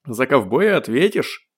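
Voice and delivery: slow, lightly playful threat; cheerful shout. A narrator's reading. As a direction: call it out in mock menace. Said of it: "крикнул гость весело". (весёлый выкрик)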